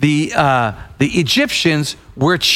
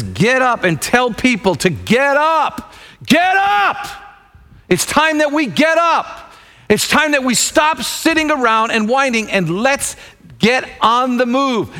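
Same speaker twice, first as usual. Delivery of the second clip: fiery preaching; 6 semitones higher than usual. Acted. Preacher urging the congregation to get up, rousing. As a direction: rouse the church to action.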